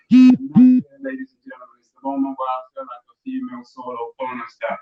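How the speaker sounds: comic closer over the music; punchline. Real, not acted. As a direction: deliver the closer big and high.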